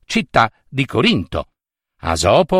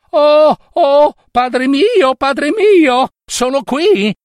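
put-on high, loud girl's cry to her father; calling out excitedly; 11 semitones higher, 4 dB louder, slower than usual. Daughter running to her father, calling out excitedly. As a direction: call out urgently in a much higher voice.

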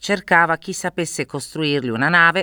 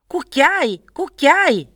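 high-pitched magpie squawk; crying magpie; 14 semitones higher, much slower than usual. A narrator's reading. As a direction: cry it out like an indignant bird. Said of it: "gridò la gazza". (grido acuto di gazza)

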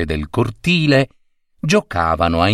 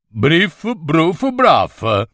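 higher, lilting nonsense answer; playful nonsense reply; 6 semitones higher, much slower than usual. Acted. Old gentleman teasing the lady in gibberish, higher. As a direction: answer her in the children's gibberish. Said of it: "rispose il vecchio signore".

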